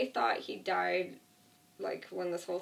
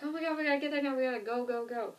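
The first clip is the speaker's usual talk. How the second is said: high, hurried mock panic, imitating the group; panicked rush; 4 semitones higher, faster than usual. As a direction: act out the group panicking to get away.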